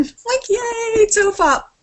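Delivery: high, delighted little cheer; excited squeal. Retold or imitated. Fan spotting Will Anderson at the festival, high excited squeal. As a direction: squeal it like spotting a favourite comic.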